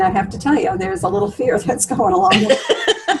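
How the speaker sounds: amused confession breaking into laughter; words giving way to a chuckle; laughing. Real, not acted. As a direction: admit it through a laugh. Laughs while admitting her own fear.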